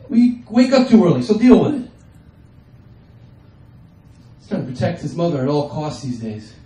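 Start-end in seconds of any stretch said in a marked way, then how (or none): 0.1-1.9 mock snapping back. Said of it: "I said".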